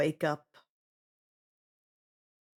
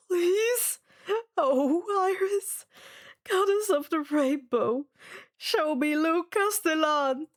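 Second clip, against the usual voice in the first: begging plea; 5 semitones higher than usual. Acted. Shaky breath, final begging try.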